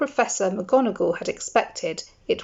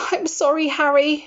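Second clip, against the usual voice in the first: high, distressed apology; wailing; 8 semitones higher, 3 dB louder than usual. A narrator's reading. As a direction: wail out the sorry. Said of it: "she wailed".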